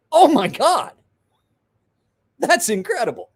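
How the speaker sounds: high mock-amazed exclaim; retold excitement. Amazed at the tattoo.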